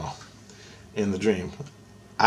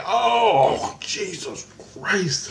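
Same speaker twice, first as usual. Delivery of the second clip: pained groan; 8 semitones higher, much slower than usual. Retold or imitated. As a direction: groan it slowly through retold heart pain. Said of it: "Like, it hurt".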